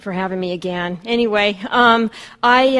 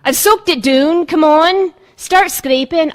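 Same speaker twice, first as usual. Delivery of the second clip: higher, louder Glaswegian mother, slow on the order; Scottish mom; 6 semitones higher, 7 dB louder, slower than usual. Retold or imitated. Daughter imitating her Scottish mother ordering her to scrape walls, Glasgow accent. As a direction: mimic a Glasgow mum giving orders. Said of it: "hearing her accent".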